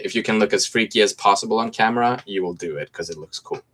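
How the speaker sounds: normal talking voice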